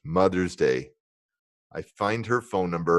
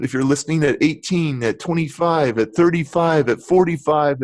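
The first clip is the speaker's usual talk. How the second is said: uplifting motivational speech; 5 semitones higher than usual. Real, not acted. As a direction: encourage every listener, building. Inspirational message to listeners.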